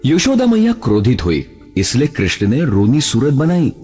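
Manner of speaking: usual speaking voice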